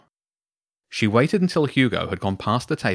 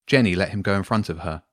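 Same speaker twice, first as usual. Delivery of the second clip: ordinary delivery, nothing marked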